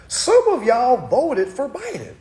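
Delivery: high, loud, drawn-out disbelief; incredulous repeat. Real, not acted. Streamer repeating that viewers voted Biden, loudly incredulous. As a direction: stretch it out loud and high, appalled. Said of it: "I'm gonna say that again".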